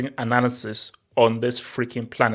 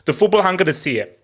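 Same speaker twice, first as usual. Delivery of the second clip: normal talking voice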